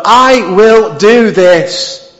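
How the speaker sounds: very slow, every word hit; definitive vow. Acted. Preacher voicing God's firm vow, very slow and deliberate. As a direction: lay each word down like a final verdict. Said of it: "It's a definitive from God".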